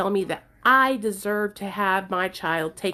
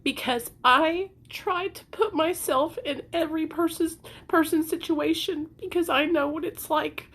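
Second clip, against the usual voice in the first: tearful; 7 semitones higher than usual. Real, not acted. Still tearful.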